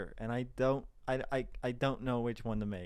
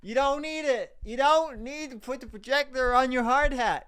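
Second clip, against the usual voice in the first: exasperated rant; 10 semitones higher, 7 dB louder than usual. Real, not acted. Ranting at the clip's projector.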